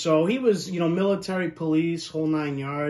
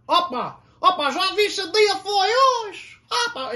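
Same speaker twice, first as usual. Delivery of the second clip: imitated Terceira accent; 8 dB louder than usual. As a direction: mimic the uncle's exaggerated Terceira accent. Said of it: "it's just the accent".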